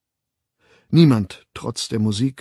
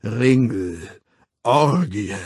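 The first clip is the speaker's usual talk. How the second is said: measured, resonant ritual intonation; booming voice; slower than usual. A narrator's reading. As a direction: boom out each word separately. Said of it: "dröhnte die Stimme".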